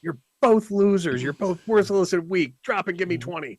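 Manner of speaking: high barking drill instructor; barking gym coach. Acted. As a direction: bark orders at weaklings like a coach. Mock drill sergeant insults.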